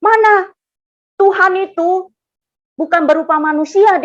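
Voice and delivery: imitated high, loud telling-off; angry correction. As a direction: scold the friend as you correct them. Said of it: "dia marah saya".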